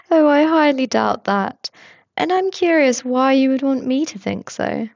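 pry with cheerful curiosity; nosy coworker Tobias. A narrator's reading.

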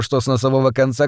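ordinary delivery, nothing marked

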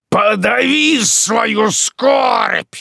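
high, drawn-out furious bellow; thundering command; loud shout. A narrator's reading. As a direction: roar the order slowly at full voice. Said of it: "закричал он громким голосом".

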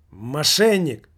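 loud angry cry of fraud; furious shout from the armchair; shouted accusation. Acted. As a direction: hurl it across the room. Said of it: "выкрикнул со своего кресла".

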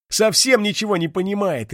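high, mocking remark; ironic. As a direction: tease him with irony. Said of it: "иронично заметила Вжих".

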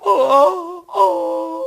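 sobbing oh, then a slow drawn-out grieving moan; wailing lament. Acted. Pumuckl weeping to Eder over his drawer, wailing sob. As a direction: mourn the lost drawer as if heartbroken. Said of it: "klage und weine".

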